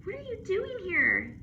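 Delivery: put-on girl amazed to find her dog; surprised girl. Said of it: "Lucy said".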